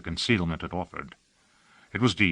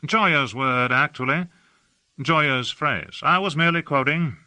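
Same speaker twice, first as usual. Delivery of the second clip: higher, airy, languid society gentleman; affected aristocrat; 6 semitones higher than usual. A narrator's reading. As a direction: gush about the city like a bored aesthete. Hawke explaining the phrase.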